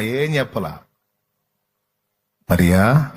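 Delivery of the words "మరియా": low, louder gentle call of a name; Jesus calling her. Acted. Jesus calls Mary by name, low and tender. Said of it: "ఆ గొంతు గుర్తుపట్టింది".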